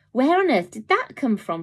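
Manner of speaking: amazed at the hidden kitten; astonished gasp. Acted. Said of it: "Fronto gasped".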